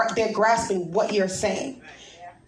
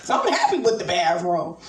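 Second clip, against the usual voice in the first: ordinary talk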